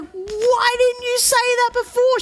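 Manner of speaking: high, slow exasperated complaint; slow, high disbelieving whine. Retold or imitated. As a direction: drag it out, fed up with mum. Frustrated retort to mum.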